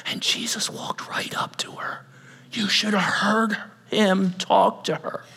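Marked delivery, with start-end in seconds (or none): 0.1-2.1 whisper. Real, not acted. Preacher drops to a whisper.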